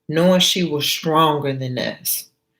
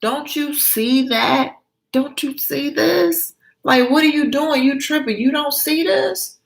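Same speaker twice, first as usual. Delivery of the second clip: exasperated friend; 9 semitones higher than usual. Acted. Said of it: "So Ann is like".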